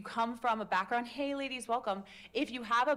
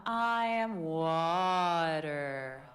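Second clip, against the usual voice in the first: very slow, steady calm voice; soothing water voice again; 3 semitones lower, 4 dB louder, much slower than usual. Shown as a demonstration. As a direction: draw it out like a meditation. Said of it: "Wanna try one more time now knowing that?".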